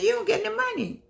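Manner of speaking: high, quick mock disbelief; skeptical friend. Retelling a friend's jab about pay: high mock disbelief. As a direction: tease it like a doubting friend.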